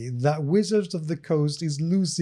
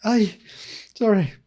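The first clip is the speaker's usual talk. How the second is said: laughing; 5 semitones higher than usual. Real, not acted. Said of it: "I can't stop laughing right now".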